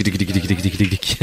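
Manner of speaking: low tick tick sounds; ticking noise. Pretend. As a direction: click it like a clock. (tic-tac)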